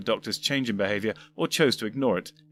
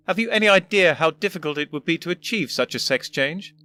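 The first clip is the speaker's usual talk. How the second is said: put-on elderly, prim, higher voice; a fussy old gentleman explaining; first doctor voice; 6 semitones higher, 4 dB louder than usual. First doctor calmly explaining to his future self, prim and higher.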